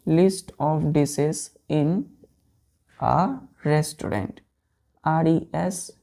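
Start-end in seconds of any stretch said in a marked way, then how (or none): 0.1-4.4 very slow, word by word; teacher dictating the meaning slowly; slow dictation. Shown as a demonstration. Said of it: "Meenu का मतलब होता है".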